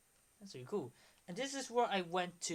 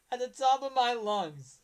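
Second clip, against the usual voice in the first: laughing; 4 semitones higher, 8 dB louder than usual. Real, not acted. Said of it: "repeated while laughing".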